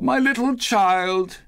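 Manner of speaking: very high, slow anguished wail; father's grief-stricken cry; Bob. A narrator's reading. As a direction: break down crying out, stretched. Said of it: "He broke down all at once".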